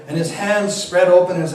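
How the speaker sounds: usual speaking voice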